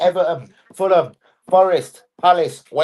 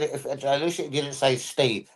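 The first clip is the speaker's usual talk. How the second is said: ordinary talk, nothing marked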